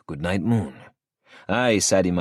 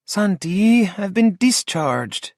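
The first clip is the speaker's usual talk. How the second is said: higher female voice, slow and groaning; groaning Lola; 5 dB louder, much slower than usual. A narrator's reading. Lola groans at Santi about discharge, higher and slow. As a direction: drag it out with a groan. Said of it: "she says with a groan".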